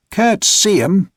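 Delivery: angry. A narrator's reading. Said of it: "now thoroughly angry".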